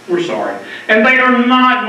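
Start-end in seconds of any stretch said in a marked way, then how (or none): 0.1-0.6 "We're sorry" dropped much lower and softer, trailing off; fading mock apology. Widower mocking a hospital's apology, dropping it low and soft. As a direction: let "We're sorry" sink away, hollow and insincere.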